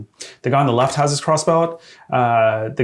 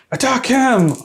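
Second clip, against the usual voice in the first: high, raised battle cry; mock command; 12 semitones higher, 4 dB louder than usual. Game master voicing the player's order to attack, high mock shout. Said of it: "you basically, like".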